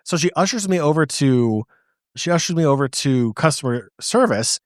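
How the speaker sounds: usual speaking voice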